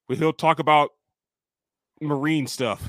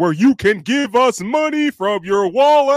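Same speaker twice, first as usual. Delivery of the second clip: hammed-up sales pitch; 8 semitones higher, 9 dB louder, slower than usual.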